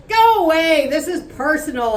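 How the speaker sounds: angry man shooing the rep away; snapping dismissal. Acted. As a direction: wave her off hard.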